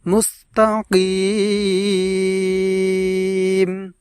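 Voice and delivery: মুস্তাকিম with কিম held long and slow; stretched কিম. Shown as a demonstration. Teacher demonstrating for viewers, কিম held long. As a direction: draw out the কিম. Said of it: "এইভাবে লম্বা করে পড়ব".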